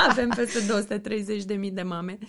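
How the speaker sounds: talking through a real laugh. Real, not acted. Guest tells host how big the mothers' community is, laughing. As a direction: let the laugh carry into the words.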